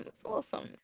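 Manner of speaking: whisper. Retold or imitated. Tempting repeated whispered.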